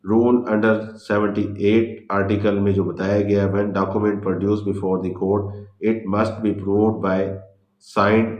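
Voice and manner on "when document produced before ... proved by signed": very slow, word by word, as if dictating notes; slow heading read. Shown as a demonstration. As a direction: spell out the heading slowly so students can write it. Dictating the rule slowly. (धीरे धीरे शीर्षक लिखवाना)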